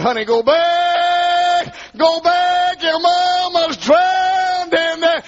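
high sung wailing cry, slow; drowning mother's cry. Retold or imitated. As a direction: wail it like a mother going under. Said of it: "And the mama said".

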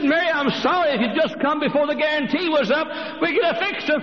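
high, sorry woman's voice; high apologetic woman; nurse apologising. Acted. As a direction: say it like a regretful nurse.

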